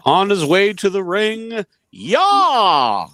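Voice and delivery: high, loud mock crowd yell; hype shout. Picturing Gunther walking to the ring, mock hype yell. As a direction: cheer his entrance like a fan.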